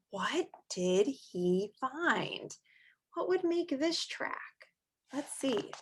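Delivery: soft, quiet questioning; hushed suspense. Acted. Teacher asking children, soft suspense. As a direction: draw the kids in softly.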